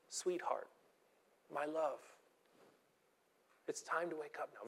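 hushed, loving wake-up call; very gentle, very tender. Acted. Jesus telling a little girl to wake up, gently and tenderly. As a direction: speak softly, as to a sleeping loved one. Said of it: "Very gentle, very tender".

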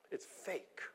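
breathy whisper; whispered verdict. Real, not acted. Preacher whispering to the congregation that it's fake. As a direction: let it drop to a whisper.

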